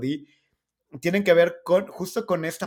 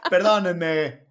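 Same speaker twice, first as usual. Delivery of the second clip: laughing; 5 semitones higher, 6 dB louder, much slower than usual. Real, not acted. Laughing apology after the bit.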